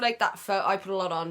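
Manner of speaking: usual speaking voice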